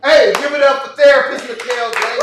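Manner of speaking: loud, high crowd-hyping call; hype shout. Real, not acted. Emcee hyping the room for the therapist, big loud shout.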